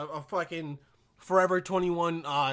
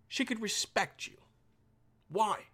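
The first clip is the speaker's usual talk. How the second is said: ordinary delivery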